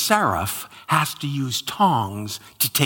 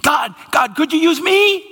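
higher, louder, pleading excitement; eager Isaiah; 6 dB louder than usual. Retold or imitated. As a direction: jump up waving, begging to be chosen.

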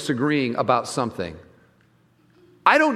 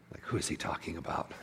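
softer, voiceless side comment; whispered aside; 12 semitones lower, 17 dB quieter, faster than usual. Real, not acted. Preacher whispering a joking aside to the congregation, voiceless whisper. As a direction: whisper it like a joke to the front row.

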